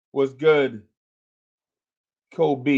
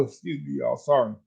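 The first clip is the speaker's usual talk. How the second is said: ordinary delivery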